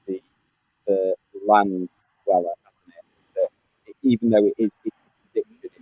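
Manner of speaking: muffled, like talking underwater; garbled connection, voice sounding submerged. Real, not acted. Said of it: "It sounds like you're underwater, Sam".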